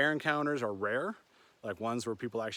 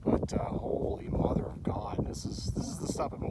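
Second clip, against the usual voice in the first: awed whisper; 3 semitones lower than usual. Real, not acted. Amazed by the scenery.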